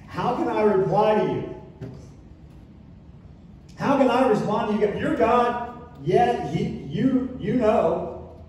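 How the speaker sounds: loud, high, flustered surrender; overwhelmed plea. Acted. Job answering God, loud and flustered. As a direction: blurt it out, overwhelmed.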